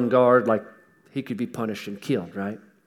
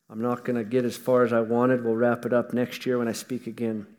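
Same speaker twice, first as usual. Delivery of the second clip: ordinary delivery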